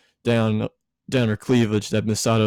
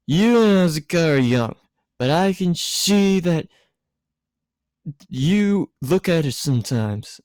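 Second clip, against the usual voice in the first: high, giddy tipsy woman, voice pitched up; tipsy Misato; 6 semitones higher than usual. A narrator's reading. Tipsy Misato lecturing Shinji, high and giddy.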